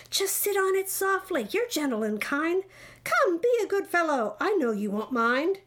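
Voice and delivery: high, sweet-talking persuasion; coaxing. A narrator's reading. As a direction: wheedle him with flattering encouragement. Bird coaxing elephant to sit.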